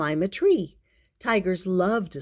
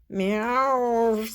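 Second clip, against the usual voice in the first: long, higher cat yowl; bad-mood meow; 4 semitones higher than usual. A narrator's reading. Kitty warning of a very bad mood, cranky meow. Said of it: "in a very bad mood".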